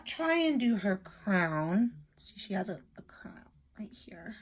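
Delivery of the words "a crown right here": normal talking voice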